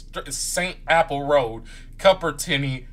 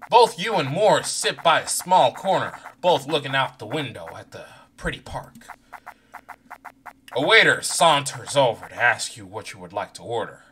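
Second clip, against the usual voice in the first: normal talking voice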